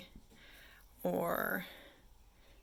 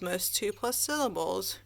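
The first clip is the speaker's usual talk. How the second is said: ordinary delivery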